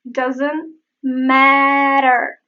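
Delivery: "Doesn't matter" very slow, T tapped; slow flap T. Shown as a demonstration. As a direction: stretch "matter", flicking the T. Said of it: "You can hear the same here".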